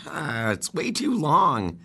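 ordinary delivery

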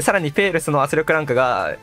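usual speaking voice

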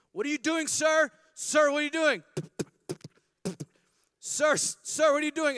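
high, loud panicked yelling; alarmed shout. Acted. Blind man shouting at Jesus as he spits on him, panicked high yell. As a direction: yell at the stranger spitting in your face.

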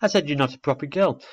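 cross retort. Acted. Owlet retorts in quarrel.

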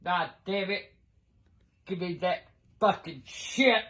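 usual speaking voice

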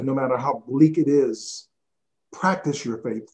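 ordinary talk, nothing marked

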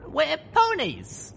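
put-on high, fast determined young mare; young heroine voice. A narrator's reading. Voiced heroine Little Pip.

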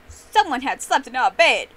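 loud angry growl. A narrator's reading. Daddy Bear finding the blankets messed up, growling. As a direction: growl it out loudly. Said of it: "Daddy Bear growled".

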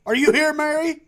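loud, high call into the room. Pretend. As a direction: call out to see if someone answers. Calling out for a character in game.

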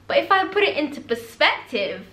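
ordinary talk

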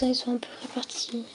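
fast unvoiced whisper aside; hurried hushed aside; quick whisper. Real, not acted. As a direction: rush it under your breath. Whispered aside while unboxing.

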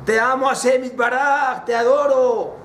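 high-pitched declaration of love; fervent cry of adoration; aloud with fervor. Shown as a demonstration. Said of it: "con voz alta".

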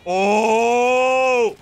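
high, loud, drawn-out cry; startled yell. Real, not acted. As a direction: yelp at the sudden hit. Sudden danger in game.

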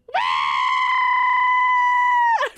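long, high, loud squeal; piercingly high drawn-out shriek; high scream. Real, not acted. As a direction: let out a sustained high-pitched scream. Overexcited outburst while playing.

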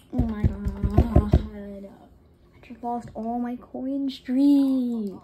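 very slow, loud drawn-out lament; slow groan. Real, not acted. Gamer mourning the lost coin streak, slow loud groan. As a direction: drag every word out in despair.

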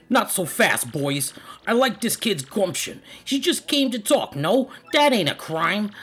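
high, swinging Italian mob boss; Italian accent. Acted. Don Pianta calling off his boys, Italian-accented voice. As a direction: hold court like a cartoon Italian don. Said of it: "I speak it in an Italian accent".

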